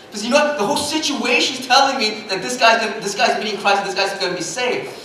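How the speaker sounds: ordinary talk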